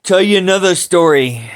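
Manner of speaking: usual speaking voice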